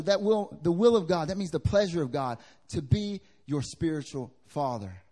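ordinary talk

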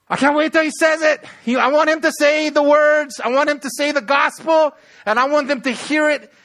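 loud, high, bursting with impatience; acted can't-wait excitement; eager anticipation. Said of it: "see the anticipation of the Holy Spirit".

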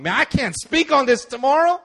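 retold anger. Preacher angry while writing his sermon, mock exasperated. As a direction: act out angry exasperation, pitched right up. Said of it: "angry like this".

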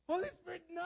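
very high, wailing mock plea; hammed-up cry of resistance, long "no"; anguished protest. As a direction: wail it in comic agony. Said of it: "I was like".

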